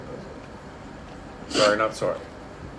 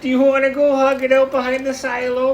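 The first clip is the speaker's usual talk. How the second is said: high, hammed-up Letterkenny Canadian accent; mock a Canadian ribbing a buddy, pitched up; poor Canadian accent; 5 dB louder than usual. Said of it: "whom we were imitating poorly".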